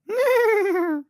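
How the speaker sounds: very high squeaky neigh; bad horse neigh. Host trying again at a horse neigh, high. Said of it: "don't know how to neigh".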